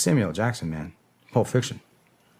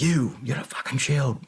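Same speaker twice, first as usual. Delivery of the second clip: retold screaming; 5 semitones higher than usual. Soldier ordering locals who don't speak English, retold high yell. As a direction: imitate screaming an order, pitched up. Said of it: "English fucking screaming".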